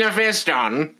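imitating the failed scary narrator; Mephiston voice again. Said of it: "Can you give us an impression?".

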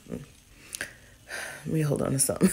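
woozy, whispered and faint; dizzy. Real, not acted. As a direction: talk like the room is spinning. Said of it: "I'm dizzy".

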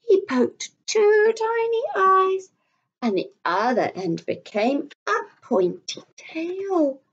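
lively storybook reading; reading to children. A narrator's reading. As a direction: read it aloud to little ones, full of wonder. Reads a picture book to children.

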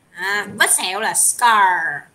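slow, drawn-out scar for learners; slow teaching voice. Shown as a demonstration. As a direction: lay each word out slowly to the class. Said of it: "mình đọc mình liên hệ vậy đó". (giọng giảng chậm rãi)

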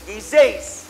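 ordinary talk, nothing marked

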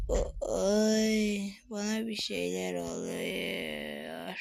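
very low, slow warped voice; slurred sinking low drone; deep slowed drugged voice. Pretend. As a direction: sound like the drug is kicking in. Feeling strange after the injection.

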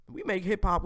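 ordinary delivery

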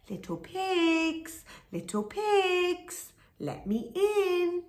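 high slow coaxing wolf; high sing-song call. A narrator's reading. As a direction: croon to the pigs. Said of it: "cried the wolf".

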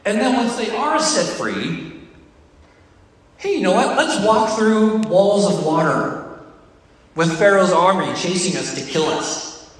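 ordinary talk, nothing marked

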